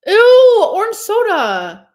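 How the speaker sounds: high, loud drawn-out "ew"; grossed out. Real, not acted. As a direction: recoil loudly in disgust. Grossed out reacting to chat.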